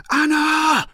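loud, high-pitched desperate shout; shouting a name. A narrator's reading. Man lost in a forest calls out for Anna, shouting. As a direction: cry the name out at full force. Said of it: "I finish shouting".

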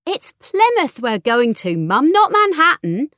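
loud, slow-starting protest climbing high; exasperated daughter. A narrator's reading. Daughter correcting her mum about Plymouth, high exasperated voice. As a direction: put a fussing mum straight, pitch soaring. Daughter scoffs at fretting mother.

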